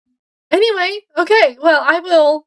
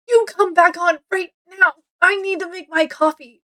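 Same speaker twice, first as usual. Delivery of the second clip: mildly stern mom voice, raised in pitch; 5 semitones higher than usual. Retold or imitated. Mom ordering the power back on for coffee, mom voice. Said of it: "you used your mom voice on the power".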